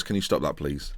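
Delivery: put-on a quiet, lightly done Scottish accent; soft Scottish voice asking them to stop. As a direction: mutter it softly in a Scottish accent. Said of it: "in Scottish".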